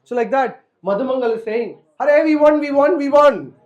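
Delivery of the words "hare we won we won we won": loud, high triumphant shout; acted-out cheering, voice raised high; excited victory cry. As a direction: call out the win before anyone can object. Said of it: "Madhumangal gets a little excited".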